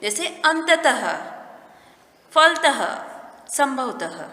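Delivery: अंततः फलतः संभवतः each ending in a breathy ह; trailing h sound. Shown as a demonstration. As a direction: let the ह sound ring at the end of अंततः. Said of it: "ह का उच्चारण पीछे लगता है". (अंततः फलतः संभवतः के अंत में ह की ध्वनि साफ़ बोलें)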